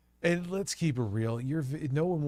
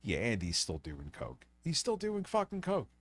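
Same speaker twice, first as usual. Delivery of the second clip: stuffy voice; 2 semitones higher, 7 dB quieter than usual. Real, not acted. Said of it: "you can hear how he sounds".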